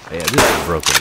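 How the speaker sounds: frustrated shout. Real, not acted. Frustrated at broken gun.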